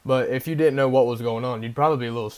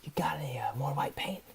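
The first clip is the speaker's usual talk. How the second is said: much softer, hushed stranger voice; imitating the paint guy; 10 dB quieter than usual. Teller imitates the man at the dumpster, hushed and soft. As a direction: half-whisper him like a spaced-out drifter. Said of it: "I swear this is how he talks".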